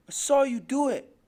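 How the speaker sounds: put-on high, loud child's accusation; defiant accusation. Boy tells his father he saw it, defiant cry.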